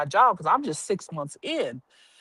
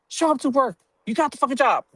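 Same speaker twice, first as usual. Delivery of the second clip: gruff warden; 7 semitones higher, faster than usual. Retold or imitated. Warden barking on phone.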